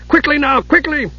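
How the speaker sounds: very high, excited urging; high-pitched frantic character voice; urgent shriek. A narrator's reading. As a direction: hurry her along in a shrieky high voice. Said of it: "Dugan shrieked".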